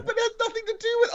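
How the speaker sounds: very high, fast objection; incredulous protest. Real, not acted. Player arguing the tagline doesn't fit, incredulous protest. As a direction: rush out a squeaky complaint. Player keeps disputing the answer.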